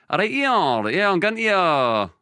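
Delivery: Glaswegian, starting high then settling; another Glaswegian attempt. Shown as a demonstration. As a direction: try out another Glasgow voice. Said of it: "Still Glasgow".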